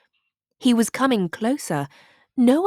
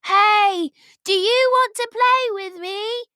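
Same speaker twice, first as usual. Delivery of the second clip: high, loud call across a field; shouted call; 13 semitones higher, 6 dB louder than usual. A narrator's reading. Leopold calling down to the shepherd, loud shout. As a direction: call down from the tower. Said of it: "He shouted".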